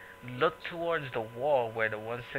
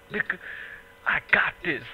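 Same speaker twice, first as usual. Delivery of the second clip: tough guy voice; 5 semitones higher than usual. Retold or imitated. Imitating a guy threatening on camera.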